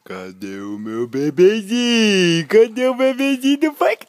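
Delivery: building squeal of play. Real, not acted. Father playing with baby.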